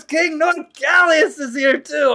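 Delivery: high, rising fanfare for a guest; excited announcement. Pretend. Streamer announcing a friend's arrival in chat, excited hammed-up welcome.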